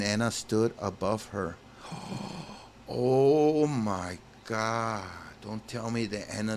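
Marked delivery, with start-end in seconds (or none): 1.8-2.6 sudden breathless gasp; sharp gasp. Real, not acted. Reacts to blood scene. 2.9-5.0 slow stunned oh my God. Real, not acted. Shocked by the scene.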